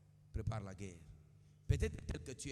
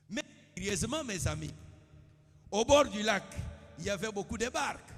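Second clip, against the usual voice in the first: loud, high sermon cry; shouted preaching in rising waves; full-force preaching; 9 semitones higher, 9 dB louder than usual. Acted. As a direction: drive each phrase out to the crowd.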